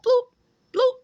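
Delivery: high squeaky bloop bloop, like a bird hopping; hopping bird noise. Retold or imitated. Vlogger imitating a cardinal hopping up stairs, squeaky bloops. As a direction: chirp it high and short, hopping along.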